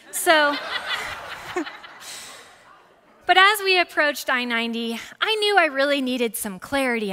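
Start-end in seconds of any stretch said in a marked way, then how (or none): 0.6-2.3 breathy laugh. Real, not acted. Laughs at her own story.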